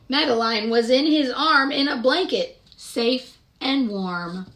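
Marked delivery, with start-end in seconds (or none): none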